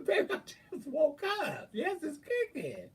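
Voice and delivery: high squealed laughing retort; retold laughing protest. Retelling morning-breath exchange, laughing.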